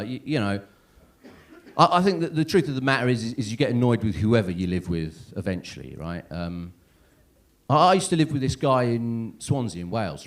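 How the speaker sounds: ordinary talk, nothing marked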